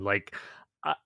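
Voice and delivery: usual speaking voice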